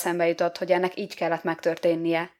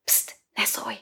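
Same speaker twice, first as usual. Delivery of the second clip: hushed, urgent shushing whisper; soft conspiratorial hiss for silence; 5 semitones higher, 5 dB quieter, slower than usual. A narrator's reading. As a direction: whisper it softly as a warning. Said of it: "suttogott Éva".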